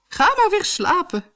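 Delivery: very high, singsong queenly voice; high-pitched storybook wife. A narrator's reading. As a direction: send the king back to sleep in a high voice. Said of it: "zei de koningin".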